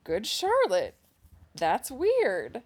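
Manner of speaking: loud, high mock revelation; exaggerated dawning surprise; surprised realization. Acts out suddenly remembering the band.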